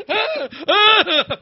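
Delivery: high-pitched yelps of horror; hammed-up short shrieks while fleeing; retold squeals of panic; mock panicked screams. Acts out panicked retreat.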